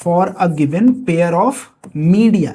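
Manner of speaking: very slow, word by word, for notes; slow dictation. Shown as a demonstration. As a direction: dictate the law so students can write it down. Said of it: "याद रखेंगे इसको". (धीरे-धीरे लिखवाते हुए)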